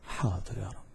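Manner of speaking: low, very soft reply with a lift; hushed, humble assent; meek obedience. Acted. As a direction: answer submissively, under your voice. Acted meek reply to God.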